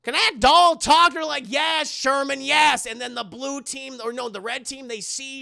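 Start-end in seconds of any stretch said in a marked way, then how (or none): 0.0-1.1 loud, high, mock disbelief; astonished shout. Sherman seeing Chucky speak, astonished shout. 1.5-2.8 high, loud, emphatic mock answer; exasperated confirmation. Kids answering Sherman about the talking doll, exasperated confirmation.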